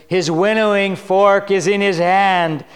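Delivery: solemn booming read of the Messiah's coming; prophetic declaration. Acted. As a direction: proclaim judgment, slowing at the end. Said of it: "John declares".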